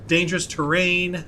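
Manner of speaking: ordinary delivery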